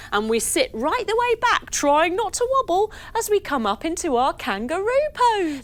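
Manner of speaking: ordinary talk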